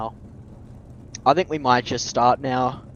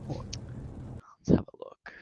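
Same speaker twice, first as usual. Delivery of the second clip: hushed whispered aside; 10 dB quieter, much slower than usual. Real, not acted. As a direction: whisper it to yourself. Aside while checking a friend.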